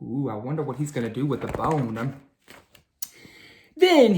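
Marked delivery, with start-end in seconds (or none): 0.0-2.2 hushed aside. Acted.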